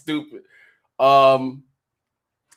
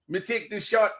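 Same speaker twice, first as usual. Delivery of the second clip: mock Jamaican accent; 6 semitones higher than usual. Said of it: "your, your West Indies".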